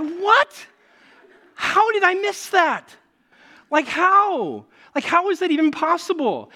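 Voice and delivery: high, loud astonished what; shocked disbelief. Retold or imitated. Pastor reliving his test failure to congregation, astonished. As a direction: act out being stunned at missing the giant toothbrush. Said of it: "And I was like".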